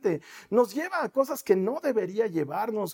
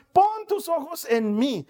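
usual speaking voice

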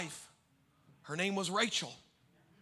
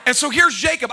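ordinary delivery

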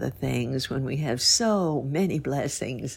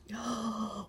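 soft gasp; 6 semitones higher, 11 dB quieter than usual. Acted. Gasp as pudding flies.

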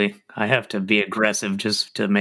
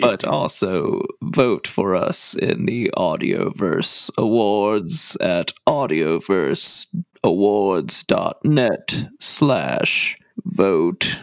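swallowed throaty voice, slow at the end; 3 semitones lower, slower than usual. Shown as a demonstration. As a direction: say "Audioverse awards dot net slash vote" with the voice swallowed into the throat. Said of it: "am swallowing my voice".

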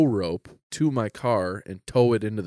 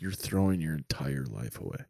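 low, soft imitation of a tearful dad; understated, heartbroken dad's plea; sad dad crying; 7 semitones lower, 9 dB quieter than usual. Dad at an intervention, understated sad crying. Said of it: "It's sad dad crying".

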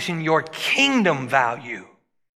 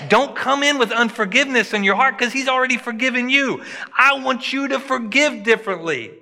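preaching at full force; 6 semitones higher than usual. Acted. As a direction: lift the congregation with a rising sermon.

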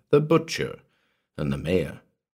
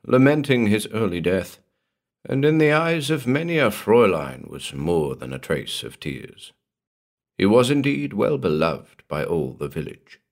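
plain reading voice

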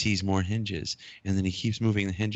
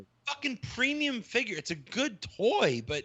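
high, incredulous dismissive rant; exasperated; 10 semitones higher than usual. Real, not acted. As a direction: scoff at the fuss. Exasperated rant about collectors.

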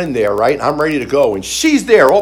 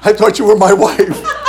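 laughing apology; 6 semitones higher, 5 dB louder than usual. Retold or imitated. Apologising to the wrong woman.

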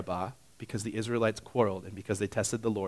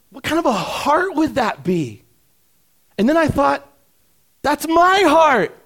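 impassioned preaching; 13 semitones higher, 9 dB louder than usual. Real, not acted. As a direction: press each question on the congregation. Preaches at full force.

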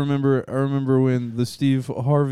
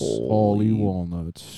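slow solemn tribute; 5 semitones lower, much slower than usual. Acted.

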